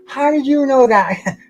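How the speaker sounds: high, slow, amazed question; drawn out in playful disbelief; mock astonishment. Tim asks how Bruce knew, mock amazed. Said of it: "He goes".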